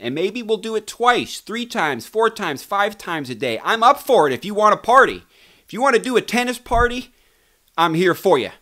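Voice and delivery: high-pitched, fast and eager; excited. Real, not acted. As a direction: hype up a tennis party. Excited offer of daily shows.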